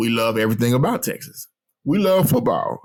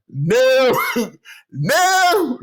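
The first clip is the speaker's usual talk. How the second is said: high, louder comic refusals; mock refusal; 10 semitones higher, 3 dB louder than usual. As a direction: refuse playfully, climbing higher through a laugh. Retells refusing, escalating, amid laughter.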